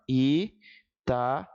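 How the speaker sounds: long pauses between five syllables; separação silábica. Shown as a demonstration. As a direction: say Italiana as isolated syllables, each on its own breath. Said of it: "Ao separar essa palavra, vai ficar assim". (silabando com pausas longas)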